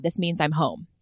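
ordinary talk

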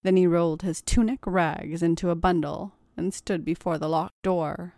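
plain reading voice

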